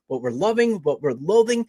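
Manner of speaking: normal talking voice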